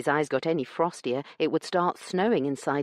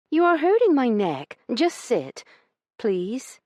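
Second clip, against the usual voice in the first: bossy; 9 semitones higher, 5 dB louder than usual. A narrator's reading. Io orders looming brother.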